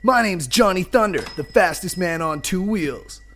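swaggering biker hero; Johnny Thunder. Acted. Biker introduces himself to mechanic, cocky. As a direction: announce yourself like an action hero.

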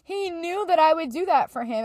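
choked-up, high testimony; crying. Real, not acted. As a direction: testify through real tears. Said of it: "because I'm crying".

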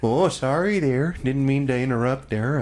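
hammed-up polite Canadian sorry; mock Canadian. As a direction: lizard apologising Canadian-style. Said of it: "I love Canadians".

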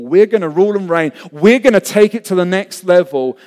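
rally him with big plans; fired-up boast. Acted. Disciples urge Jesus on to rule, fired-up insistence.

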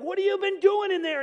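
high, loud demanding call; the waiting crowd calling out, fed up; impatient crowd. Retold or imitated. Crowd outside the temple calling to Zechariah, loud and impatient. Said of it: "And they're like".

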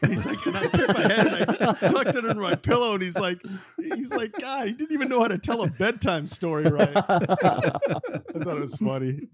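high, laughing through the words; can barely keep from laughing. Real, not acted. Retelling the comedian's story to friends, laughing high.

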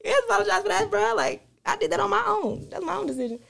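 high-pitched playful protest to a tearful father; mock younger self. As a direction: squeak it out as your younger self brushing off dad's apology. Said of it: "I'm like".

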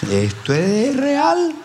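high wailing mock panic; frightened. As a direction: cry it out in sudden terror. Pastor realizes demon is real.

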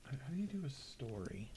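whispered aside. Real, not acted. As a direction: whisper it off-mic. Asks quietly while other records.

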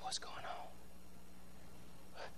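slow hushed whisper of the crowd. Acted. Bystanders in the crowd whispering, slow and hushed. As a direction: breathe it like a nosy bystander.

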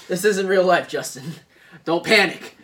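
loud, joking admonishment; loud mock warning. Real, not acted. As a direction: call it out loudly to a worried fan. Jokingly calming down a listener.

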